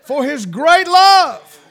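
slow, loud, high swell on "great love"; swelling to a preaching cry. Real, not acted. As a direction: let it swell and ring out.

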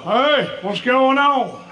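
put-on high, slow hearty old man greeting; dad on the phone. Dad answering his son's call, hearty and slow. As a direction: answer the phone big and cheerful.

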